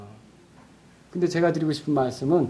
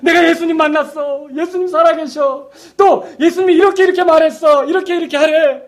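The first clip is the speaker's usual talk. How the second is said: bubbling over, much higher, breathless with joy; excited Mary; 9 semitones higher than usual. Retold or imitated. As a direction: burst out the news like Mary who just saw him risen. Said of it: "자기가 경험한 걸 얘기해요".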